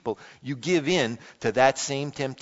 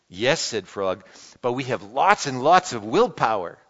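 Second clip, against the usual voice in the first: lively read-aloud with voiced characters; animated picture-book reading; storybook reading; 4 dB louder than usual. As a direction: read it like a children's story to the congregation. Reads Frog and Toad aloud.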